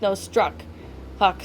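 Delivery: ordinary delivery, nothing marked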